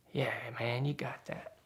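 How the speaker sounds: soft, whispered coaxing. Retold or imitated. Older guy urging him up the hill, whispering. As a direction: whisper it like tempting a friend. Friend's coaxing quoted a second time.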